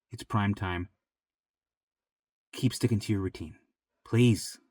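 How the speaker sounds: low, soft, consoling voice; sit beside them and quietly coax them on; tender low-voiced pep talk to a struggling friend; gentle reassurance. Sits with struggling listener, gently consoles.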